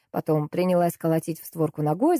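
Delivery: ordinary delivery, nothing marked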